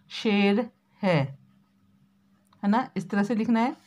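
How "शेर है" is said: शेर है drawn out very slowly; slow dictation. Shown as a demonstration. Teacher dictates शेर है slowly to the class. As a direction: stretch शेर है for writing it down. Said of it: "बड़ा श आएगा".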